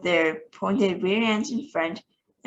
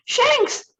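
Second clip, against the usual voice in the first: very high, loud frantic call; crying out; 5 dB louder than usual. Acted. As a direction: call the name desperately. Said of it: "cried the president".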